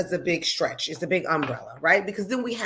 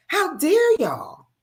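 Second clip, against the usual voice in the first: high, slow, indignant; indignant outrage; 10 semitones higher, 3 dB louder, slower than usual. Real, not acted. Scolding officers for treating women badly, outraged. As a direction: drag out the outrage.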